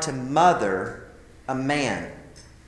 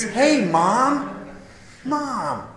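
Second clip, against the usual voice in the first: higher, drawn-out happy greeting to mom; calling out to mom; 9 semitones higher, much slower than usual. Retold or imitated. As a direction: stretch out the call to mom.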